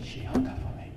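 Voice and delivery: a lost man whispering to himself; hushed despair. Retold or imitated. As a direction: let the words fall away, helpless and hushed. Man despairs after hang-up.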